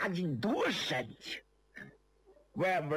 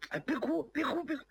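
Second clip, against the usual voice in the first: flustered hushing; 10 semitones higher, much faster than usual. Acted. Flustered youth hushes crying man.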